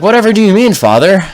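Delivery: high, sweet fake-innocent voice; mock innocence. Son playing innocent with his father on the phone, high mock-sweet. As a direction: play dumb, lilting up high like a good boy.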